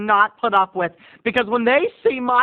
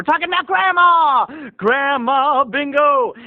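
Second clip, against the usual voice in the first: big game-show announcement, high and loud; announcer hype; 6 semitones higher, 5 dB louder, slower than usual. Acted. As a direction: hype up Grandma like a TV host.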